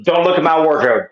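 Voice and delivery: loud comic warning yell; mock shout. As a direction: bellow it like an angry homeowner.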